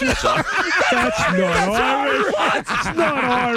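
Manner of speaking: real incredulous protest, drawn out; high, slow, laughing objection; laughing disbelief. Real, not acted. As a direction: stretch it out in amused outrage. Disbelief at supposed Irish clip.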